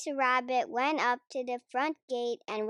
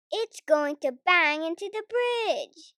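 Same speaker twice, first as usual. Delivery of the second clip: louder urgent call; shouted warning; 5 semitones higher, 6 dB louder than usual. A narrator's reading. Danny Dog warning about the tall ship, shouting. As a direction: warn everyone fast. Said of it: "shouted Danny Dog".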